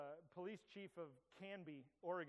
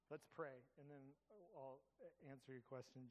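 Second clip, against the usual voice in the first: usual speaking voice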